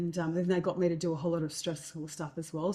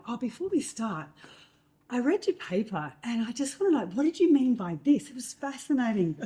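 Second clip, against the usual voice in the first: gushing flattery; 6 semitones higher, 4 dB louder than usual. Retold or imitated. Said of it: "puffed his chest out".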